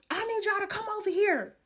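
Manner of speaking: high put-on voice; lightly voiced girlfriend calling; girlfriend on phone.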